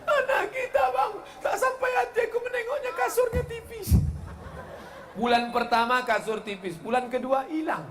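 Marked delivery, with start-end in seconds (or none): 0.0-4.0 very high falsetto whining sob; mock crying wife. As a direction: wail like a weepy wife. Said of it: "ibu itu pun menangis". (istri merengek menangis dengan suara sangat tinggi)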